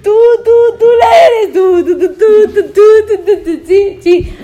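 high, loud, stuck on the word tú; frantic repeating. Real, not acted. Pointing out her friend, loud and giggly. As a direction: hammer the word over and over, half laughing.

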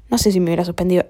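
normal talking voice

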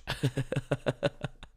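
long high chuckle; laughing. Real, not acted. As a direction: crack up at your own act. Laughs at own prophet act.

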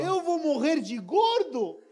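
high, loud, drawn-out disbelief; incredulous outrage. Retold or imitated. Imagining a ridiculous death among pastries, loudly incredulous. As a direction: wail the question in comic indignation. Acts out absurd fear, comic outrage.

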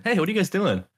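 high, chirpy mock greeting; imitated greeting to women. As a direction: greet a group in a put-on high voice. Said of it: "like, it's all women".

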